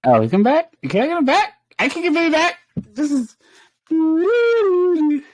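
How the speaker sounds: high, loud eager questions; excited high hope. Real, not acted. Gamer hoping to get Benny back, high and loud. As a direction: perk up, thrilled.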